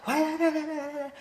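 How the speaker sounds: high, fast babbling yeses; eager chatter. Retold or imitated. Chattering away while telling stories.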